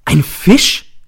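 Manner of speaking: loud, high startled cry; shocked exclamation, rising sharply; shouted. A narrator's reading. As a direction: cry it out as if struck. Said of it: "Ich springe auf und schreie".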